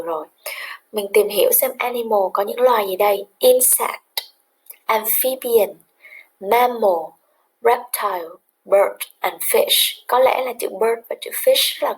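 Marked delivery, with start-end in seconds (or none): none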